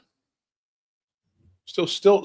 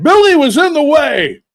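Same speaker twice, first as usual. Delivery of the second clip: put-on higher, louder old man; grandpa voice; 9 semitones higher, 6 dB louder than usual. Grandpa explaining to dad, higher and louder. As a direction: blurt it like a careless grandpa. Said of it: "They go".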